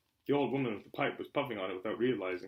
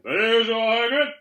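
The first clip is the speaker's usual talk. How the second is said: high, loud, slow hearty greeting; cheery barman; 10 semitones higher, 15 dB louder, much slower than usual. A narrator's reading. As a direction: call out across the pub.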